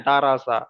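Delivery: light "রা" with open aa; thin "রা". Shown as a demonstration. Teacher checking that students understood, "রা" said thin. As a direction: say "রা" unrounded, as raa. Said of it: "বারিক হয়ে যাবে".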